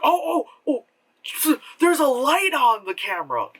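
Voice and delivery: high, mock surprised oh oh; mock discovery. Vlogger mocking a found footage character, high mock surprise. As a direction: fake amazement at finding a light. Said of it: "suddenly it's like".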